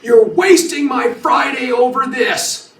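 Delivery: loud, high mock outrage; going nuts. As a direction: imitate an indignant suspect yelling in protest. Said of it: "he's going nuts in the car".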